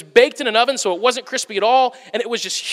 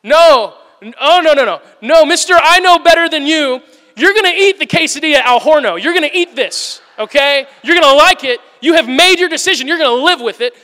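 loud, higher pretend scolding of a customer; 4 semitones higher, 6 dB louder than usual. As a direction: scold him like a fed-up waiter, just pretending. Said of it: "part of me wanted to be like".

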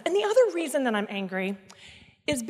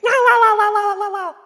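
put-on loud, high childish la-la-la; kid refusing to listen; 9 semitones higher, 10 dB louder than usual. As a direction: drown out your parents like a stubborn kid. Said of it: "like when you were a kid".

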